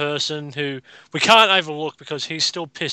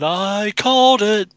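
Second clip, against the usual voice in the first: slow, stretched-out brag; proud crow with the words held long; 5 semitones higher, 4 dB louder, much slower than usual. Real, not acted. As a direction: draw it out triumphantly. Crowing over a correct prediction.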